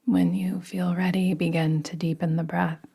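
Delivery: guided meditation. Acted. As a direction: ease them gently into rest. Leads a yoga nidra meditation.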